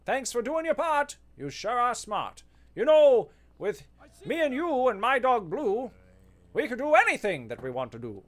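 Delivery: put-on high, cheery children's show host; lilting kids' TV sign-off; sing-song Blues Clues style host. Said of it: "Blues Clues host".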